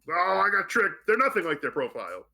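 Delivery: mock outcry, settling toward the end; mock dismay. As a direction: act out a duped date's protest. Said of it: "and they're like".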